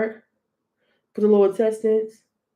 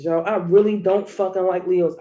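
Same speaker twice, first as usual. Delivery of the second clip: ordinary talk, nothing marked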